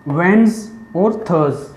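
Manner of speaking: normal talking voice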